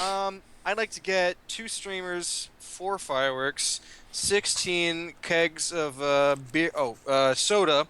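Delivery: slow, drawn-out order. Pretend. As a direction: read the order slowly. Ordering at the clerk.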